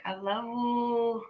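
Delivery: high, long drawn-out sweet voice; imitating how she says it to [name]; cutesy love you. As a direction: stretch it out high and sugary. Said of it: "that's how she said it to me".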